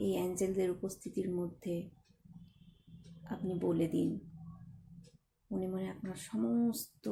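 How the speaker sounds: soft, even meditation voice with long pauses; guided meditation. Acted. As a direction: lead them gently inward, phrase by phrase.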